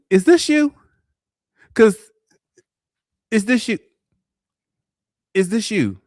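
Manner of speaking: high-pitched, disbelieving question to a date; high incredulous double-take, repeated after pauses. Pretend. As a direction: squeak it out in mock disbelief. Acts out disbelieving look at date.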